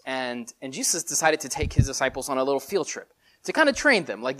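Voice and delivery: normal talking voice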